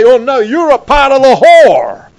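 hammed-up zealous denunciation; accusing preachers. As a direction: rail at the doubter like a hellfire preacher. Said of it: "they would say".

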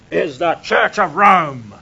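a hint of zealous certainty; emphatic. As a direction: hammer it like an old preacher. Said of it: "it was taught to me very emphatically".

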